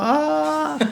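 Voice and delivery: long, very high comic wail; high drawn-out "ah" wail; mock crying scream. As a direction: imitate a child's crying yell. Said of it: "yo lloraba y gritaba".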